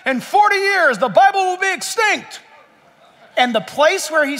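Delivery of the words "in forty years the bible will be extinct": higher, louder proud boast; Voltaire's hammed-up boastful prediction; mock proclamation. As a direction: proclaim it like a smug philosopher. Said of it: "Voltaire said".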